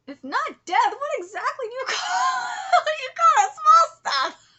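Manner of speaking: high shout breaking into laughter; laughing outburst. Acted. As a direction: lose it laughing mid-line, very loud. Outburst while reading the dialogue.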